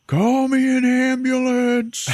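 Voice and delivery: high, loud, drawn-out cry for help; hammed-up wounded man's holler; yelling out. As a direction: imitate a shot man calling across a warehouse. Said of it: "starts yelling out".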